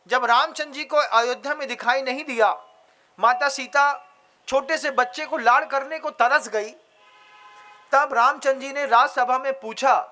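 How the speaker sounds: ordinary delivery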